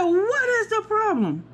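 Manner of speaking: high, slow outraged demand; going off. Retold or imitated. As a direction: demand it, indignant. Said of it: "Y'all know I went off".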